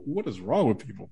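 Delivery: ordinary delivery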